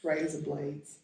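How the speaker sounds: softly trying an Australian "razor blades"; Aussie accent attempt. Shown as a demonstration. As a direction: test the Australian accent under your breath. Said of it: "I'm saying it like that".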